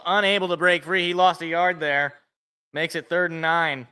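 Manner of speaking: mock broadcaster calling the play, building up. Streamer doing play-by-play of the Lions game, announcer voice. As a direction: call it like a TV announcer, rising with the run.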